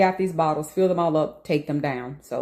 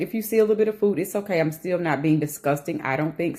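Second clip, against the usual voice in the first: ordinary talk, nothing marked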